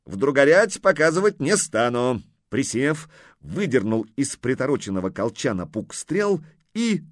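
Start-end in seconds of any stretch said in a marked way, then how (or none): 0.0-2.2 raised, louder boast; merry exclamation. A narrator's reading. Horseman boasting before his shot, exclaiming merrily. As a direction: call it out as a cheerful challenge. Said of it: "воскликнул он весело".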